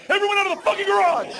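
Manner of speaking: high, loud furious shout; hammed-up bellow; angry homeowner yell. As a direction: clear everyone out of the garage. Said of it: "homeowner had to come out and be like".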